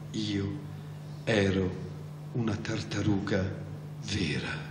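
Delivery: low, soft, halting words with long pauses; mournful. A narrator's reading. Mock Turtle tells its past to Alice, mournful. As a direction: begin the tale sorrowfully, word by word.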